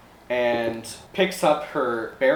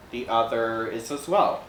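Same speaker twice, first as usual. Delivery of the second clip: ordinary talk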